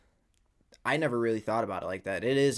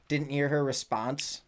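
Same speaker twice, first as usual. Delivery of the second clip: normal talking voice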